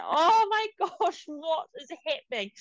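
high, slow stretched panic. Retold or imitated. Mom reliving her panic in Rarotonga, high. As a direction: drag it out in high disbelief. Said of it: "it was like".

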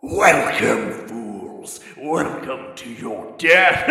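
slow, menacing, booming villain; booming evil voice. Acted. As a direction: boom it out like a dark spirit. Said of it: "a voice booms, a malevolent, evil voice".

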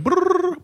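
high rolled brrr; mock normal ring; phone ring imitation. Shown as a demonstration. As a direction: imitate an old phone bell. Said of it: "normal ring".

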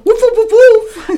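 high yappy little dog woofs; poodle bark. Retold or imitated. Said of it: "'Cause the poodle's gonna go".

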